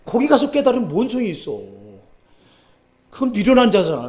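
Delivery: loud, high fervent preaching; preaching at full force. Acted. Preacher warning the congregation, loud and fervent.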